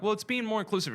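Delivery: high mocking preachy tone. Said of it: "when they're like".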